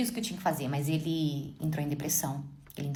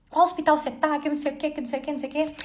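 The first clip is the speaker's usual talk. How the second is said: frantic husband; 9 semitones higher, 4 dB louder, faster than usual. Retold or imitated. Frantic husband asking which hospital.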